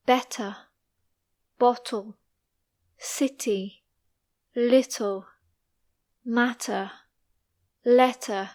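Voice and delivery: British words with a crisply released T; clear, strong T. Shown as a demonstration. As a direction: hit every T clearly, no flapping. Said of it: "clear and strong T sound".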